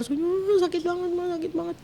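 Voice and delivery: high, strained child's whimper, imitated; stifled hysteria. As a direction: re-enact hysterics that can't come out. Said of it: "histeris tapi nggak bisa keluar".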